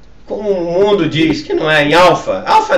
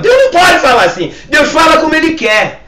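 high, loud sermon cry; forceful preaching; 5 semitones higher, 6 dB louder than usual. Real, not acted. Pastor answering doubters about how God speaks, loud forceful preaching. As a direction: argue it out to the congregation at full voice.

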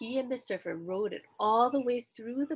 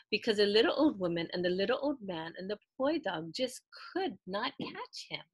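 ordinary delivery

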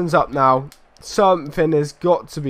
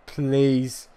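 ordinary talk, nothing marked

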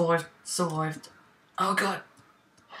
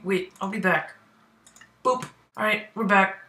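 normal talking voice

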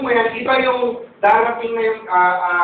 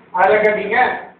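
ordinary talk, nothing marked

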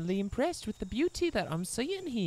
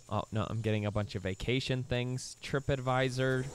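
ordinary delivery

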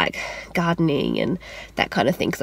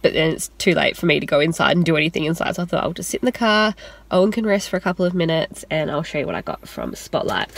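ordinary delivery

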